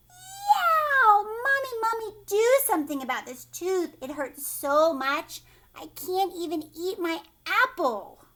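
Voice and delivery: high, loud wail settling into loud complaint; yelling in pain. Acted. Hurt boy begging Mommy to fix his tooth, yelling. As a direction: cry out like a hurt child. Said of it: "and yelled".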